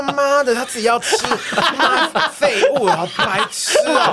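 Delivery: high, loud, slow laughing curse; inner mock rant. Kid cursing the classmate in his head, mock angry. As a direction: spit out the insults, half laughing. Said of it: "我的内心就是说".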